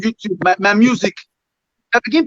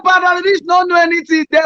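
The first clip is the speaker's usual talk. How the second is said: shouting for their attention from the stage; 6 semitones higher than usual. Retold or imitated. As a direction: yell it out to the crowd. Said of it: "I dey shout for their name".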